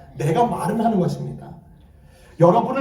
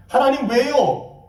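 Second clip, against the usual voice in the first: high-pitched, protesting complaint to God; pastor's lament; 5 semitones higher than usual. Acted. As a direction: protest to God, then quit. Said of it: "목회자들은 탄식하는 것이에요".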